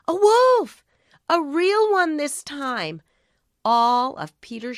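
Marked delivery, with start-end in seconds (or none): 0.1-3.0 loud, high burst of alarm; alarmed cry. A narrator's reading. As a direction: gasp out the danger. Narrator's alarm at real wolf.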